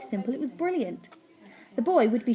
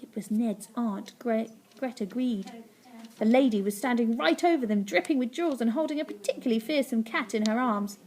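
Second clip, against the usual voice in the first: ordinary delivery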